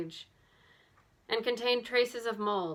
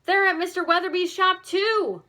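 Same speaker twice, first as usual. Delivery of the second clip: much higher and louder, breathless alarm; excited; 10 semitones higher, 9 dB louder than usual. A narrator's reading. Nancy tells the girls the earrings are at the shop, excited. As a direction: blurt the discovery out. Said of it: "Nancy exclaimed when she saw them".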